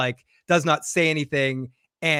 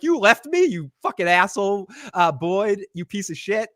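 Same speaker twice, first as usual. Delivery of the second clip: mock angry yell; 5 semitones higher than usual. [name] yells at departing [name].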